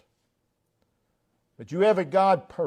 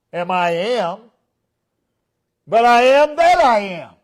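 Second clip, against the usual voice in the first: preached loud; 5 semitones higher, 8 dB louder than usual. Acted. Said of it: "preaching at full force".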